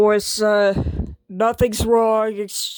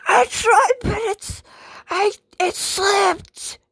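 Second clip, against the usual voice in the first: mock crying; 8 semitones higher than usual. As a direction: sob the excuse out like a hurt kid. Crying excuse after losing voice.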